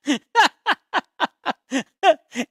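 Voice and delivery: high, loud burst of laughter; long high-pitched cackle of laughter; laughing. Real, not acted. Joking about YouTubers loving themselves, high laugh. Cracks up at own remark.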